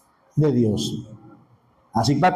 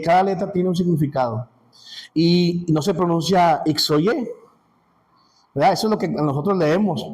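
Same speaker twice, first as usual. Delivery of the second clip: usual speaking voice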